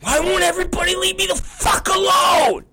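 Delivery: high, strained, fast wail; retold exasperated yelling. Dad begging to be left alone, high exasperated yelling. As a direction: cry out as if at your limit. Re-enacting angry phone message.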